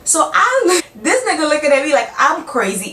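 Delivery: high, fast exasperated rant; outraged exasperation. Real, not acted. Outraged at being called crazy.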